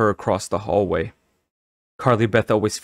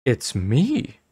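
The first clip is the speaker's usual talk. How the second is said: astonished cry; 4 semitones higher than usual. A narrator's reading. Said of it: "she cried".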